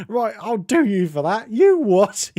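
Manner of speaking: high, loud Cockney football-fan rant; angry Cockney. Retold or imitated. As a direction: imitate a Cockney raging after his team lost. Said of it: "footballer sort of Cockney voices".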